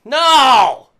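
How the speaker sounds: loud, high drawn-out cry; hammed-up protest shout; mock refusal. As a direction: holler a playful, dramatic no. Mock refusal to hit the button.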